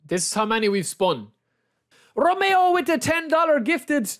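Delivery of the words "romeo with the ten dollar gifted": excited shout-out. Real, not acted. Thanks a donor excitedly.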